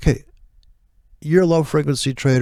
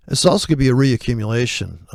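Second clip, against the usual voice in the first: normal talking voice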